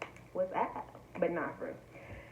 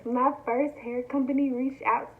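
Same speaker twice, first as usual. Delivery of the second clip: higher, louder announcement; excited shout; 7 semitones higher, 10 dB louder than usual. Shown as a demonstration. Vlogger announcing her first sponsor, loud and higher. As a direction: blurt the big news out loud. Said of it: "Let's try it again".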